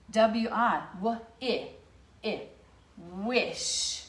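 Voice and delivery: letters, "w-, i", then "wish" with a hissed sh; sound it out. Shown as a demonstration. Teacher sounding out wish for the class. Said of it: "these words end in the S H".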